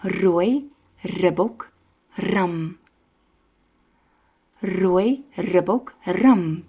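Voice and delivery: Rooi ribbok ram slowly with trilled Rs; slow tongue twister. Shown as a demonstration. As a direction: roll every R slowly. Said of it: "fun tongue twister to practice the R".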